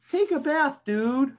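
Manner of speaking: high fly voice telling the pigeon to wash. Acted. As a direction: tell the pigeon off like a cheeky fly. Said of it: "this fly here says".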